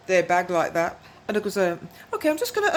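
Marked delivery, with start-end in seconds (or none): 2.1-2.7 sugary polite. Retold or imitated. Sweet voice to rude customers.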